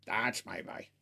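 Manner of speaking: deep, hushed mock Henry praising his dog; imitating Henry. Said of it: "doesn't sound like his cadence".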